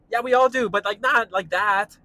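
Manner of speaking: loud, high exasperated retort; riled up, argue back high and loud; heated. Real, not acted. Answering her love of Humphrey Bogart, heated. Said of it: "That really did get me heated up".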